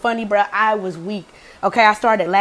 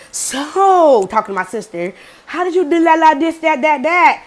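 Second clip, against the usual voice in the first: higher, gushy mimicry; mock eager interest; gushing interest; 6 semitones higher than usual. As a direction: imitate someone fawning over a new friend. Girl fawning over the sister.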